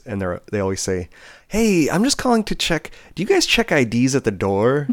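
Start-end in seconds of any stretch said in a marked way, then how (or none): 1.5-4.9 high-pitched imitation of a young caller. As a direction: put on a naive youngster's phone voice. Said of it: "it's a very young voice".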